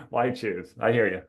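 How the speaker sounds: ordinary talk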